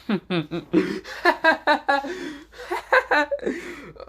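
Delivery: high, long villainous laugh; gloating laugh. Acted. Asta laughs over the pinned Lucas, a gloating high laugh. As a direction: laugh down at the beaten man. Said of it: "Asta chuckles and says".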